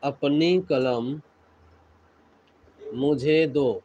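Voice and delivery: slow, broken into chunks; a teacher dictating slowly, pausing between phrases; slow dictation. Shown as a demonstration. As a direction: spell it out slowly for the class. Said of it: "teacher repeats slowly, word by word".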